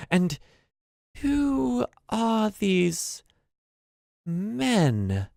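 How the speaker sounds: high, very slow, drawn-out question; concerned old maid. Acted. Maid notices strangers in the house, slowly and with concern. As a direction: stretch each word out warily, high-pitched. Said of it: "She looks concerned".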